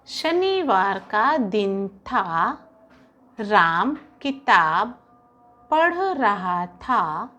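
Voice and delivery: very slow, word by word; slow dictation. Shown as a demonstration. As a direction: dictate each word slowly for neat writing. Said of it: "सुलेख किस तरह से लिखेंगे?". (सुलेख के लिए बहुत धीरे-धीरे बोलकर लिखवाना)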